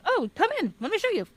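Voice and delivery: high-pitched, comically eager guard voice; playful squeaky-high welcome; welcoming guard. Retold or imitated. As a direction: usher the visitor in, way up high.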